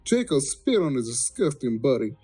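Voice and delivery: usual speaking voice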